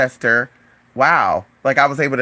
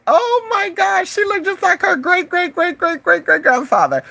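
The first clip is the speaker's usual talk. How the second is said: amazed exclamation; 13 semitones higher than usual. Retold or imitated. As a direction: retell it in a high, astonished squeal. Reacting to dog's resemblance.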